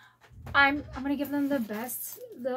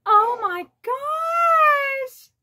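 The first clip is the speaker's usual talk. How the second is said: much higher, louder, very slow squeal; thrilled squeal; 9 semitones higher, 6 dB louder, much slower than usual. Real, not acted. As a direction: drag it out in a delighted shriek. Thrilled by the bag placement.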